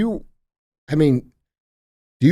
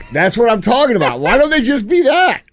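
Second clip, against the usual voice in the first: excited; 10 semitones higher, 5 dB louder than usual. Real, not acted. Cheering the clip's reporter.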